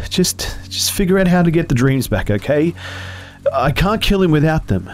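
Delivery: put-on boy pleading after crying; tearful Jesse. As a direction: urge her through tears.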